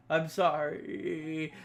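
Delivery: very slow, drawn-out whimper; mock tearful apology. [name] apologising after being told to stop, mock whimper.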